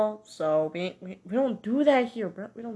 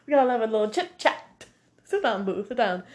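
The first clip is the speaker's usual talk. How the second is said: sassy scolding; faster than usual. Pretend. Scolding character like a parent.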